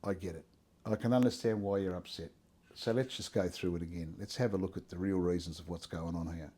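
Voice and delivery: gentle God. Pretend. God calmly reassuring an upset believer, soft and low. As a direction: soothe like a patient father, quiet and low. Voicing God's calm reply.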